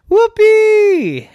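high, loud joyful whoop; gleeful shout; whoopee cheer. Acted. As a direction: let the boy whoop for joy. Boy cheering.

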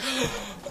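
high, soft intake of breath; gasp. Retold or imitated. Gawking at the brawl like little kids, awed gasp. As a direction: gasp like an awestruck little kid.

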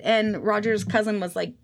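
usual speaking voice

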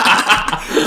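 high, loud, breathy laughter; punchline laugh. Real, not acted. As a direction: burst out laughing after the joke.

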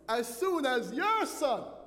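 put-on bitter older brother, slow and loud; angry brother. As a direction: spit out his grievance, dragging it. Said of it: "are you listening to this angry brother".

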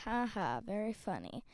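low, soft, deadpan fake laugh; unamused. Interviewer answering host's pun, dry and unamused. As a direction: give the joke nothing.

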